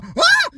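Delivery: high loud mock scream; panicked shriek. Imagining the neighbour fleeing with a high mock shriek. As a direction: shriek like she's running scared.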